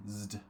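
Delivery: Zd said alone as a buzzed cluster; Zd, voiced ending alone; isolated zd ending. Shown as a demonstration. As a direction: isolate the Zd sound of advised. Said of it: "I advised him".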